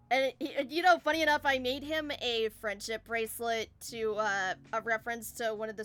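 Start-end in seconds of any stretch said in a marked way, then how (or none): none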